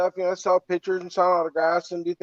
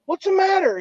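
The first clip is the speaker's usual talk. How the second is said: gentle question; 12 semitones higher, 3 dB louder than usual. Retold or imitated. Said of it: "and I'm like".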